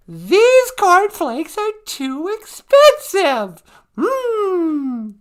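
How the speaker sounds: high, loud, very slow whiny mock fury; hammed-up fake anger. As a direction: overact outrage at the price, drawing it out. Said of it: "Lights, camera, action".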